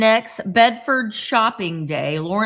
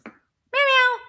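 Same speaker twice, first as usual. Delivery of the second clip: a sudden high strained meow, copying the cat; imitated cat yowl. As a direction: yowl like a scared cat at the vet. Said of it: "It was like guttural alien".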